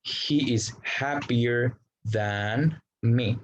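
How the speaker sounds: each English word read slowly and clearly for learners; slow example sentence. Shown as a demonstration. Teacher reading an English example to Spanish-speaking students, slow and careful. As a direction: model the sentence slowly for the class.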